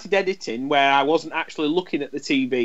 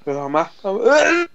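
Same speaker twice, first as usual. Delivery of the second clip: about to sneeze, breath catching; sneezing; 6 semitones higher, 4 dB louder, slower than usual. Real, not acted. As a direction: gasp as a sneeze builds. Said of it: "I'm gonna sneeze here".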